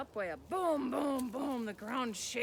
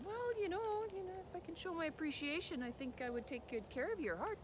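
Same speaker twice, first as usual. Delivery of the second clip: put-on much higher, softer wheedling boy voice; boy's sweet talk; 7 semitones higher, 5 dB quieter than usual. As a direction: coax the troll sweetly and innocently.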